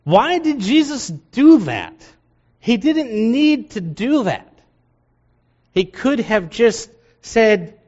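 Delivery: high, slow baffled questioning; puzzled reader. Retold or imitated.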